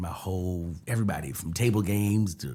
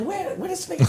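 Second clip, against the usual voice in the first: high, laughing who-is-that; high disbelief; 4 dB quieter than usual. Retold or imitated. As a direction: look around for the star. Retold disbelief at hype.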